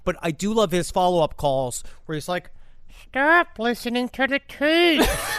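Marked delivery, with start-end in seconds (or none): 3.1-5.3 slow, high put-on voice; badly covered-up caller voice; disguised crank-call voice. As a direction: disguise your voice, pitched up and drawn out. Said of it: "trying to cover up the voice".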